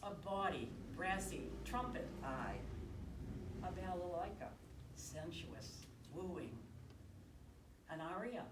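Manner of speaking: slow, measured poem recital with long pauses; poetry reading. Acted. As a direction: linger on each short phrase, pausing between them. Reads own love poem aloud.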